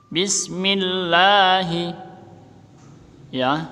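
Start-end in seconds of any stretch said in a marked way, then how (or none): 0.1-1.9 Bismillahi slow with the lam held long; drawn-out demo. Shown as a demonstration. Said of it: "Ini tetap dibaca secara panjang".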